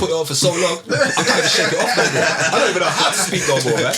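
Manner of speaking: fast, laughing protest; half-laughing mock complaint; retold own excuse. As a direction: rush it out, laughing it off. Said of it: "And I was like".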